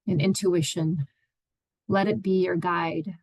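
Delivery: unhurried meditation guide, long pauses between phrases; guided meditation. Acted. Therapist guiding listeners into their own space, meditative and unhurried. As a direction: ease listeners into stillness, leaving space after each phrase.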